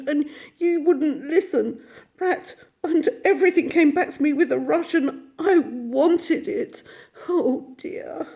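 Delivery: high, loud, tearful outpouring; tearful rush of homesick grief; sobbing. A narrator's reading. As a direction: pour it out between sobs. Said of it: "he sobbed at last".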